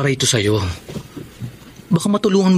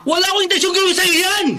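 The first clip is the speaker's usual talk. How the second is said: much higher shouted denial. Acted. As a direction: yell that you never meant it. Husband yells denial. (sigaw na pagtanggi)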